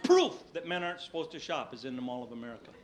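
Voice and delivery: ordinary delivery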